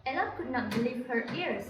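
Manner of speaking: ordinary talk, nothing marked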